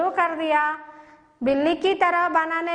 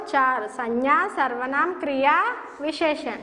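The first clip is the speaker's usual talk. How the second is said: drilling a list; 3 semitones lower than usual. Shown as a demonstration. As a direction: chant the list for children to repeat. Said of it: "teacher drills list for pupils". (बच्चों को रटाने वाला सुर)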